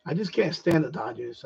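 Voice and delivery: ordinary talk, nothing marked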